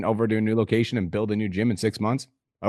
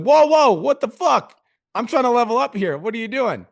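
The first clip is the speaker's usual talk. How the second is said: high, loud mock indignation; mock outrage; 12 semitones higher, 4 dB louder than usual. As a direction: act out being blindsided, shrill and loud. Mock outrage at life's punch.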